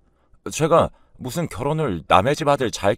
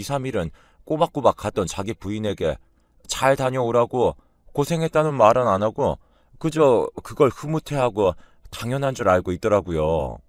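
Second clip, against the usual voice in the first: ordinary delivery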